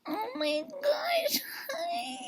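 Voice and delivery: tiny, very high drawn-out squeal; mock starstruck contestant. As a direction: squeal softly, stretching each word.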